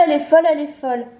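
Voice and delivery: rapid, high, loud babbling; too much caffeine. Real, not acted. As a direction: rattle it off, giddy and wired. Repeats faster, more hyped.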